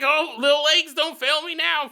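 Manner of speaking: put-on a small kid egging his legs on, half laughing; little boy. Philip urging his legs to run past the flames, mock child voice.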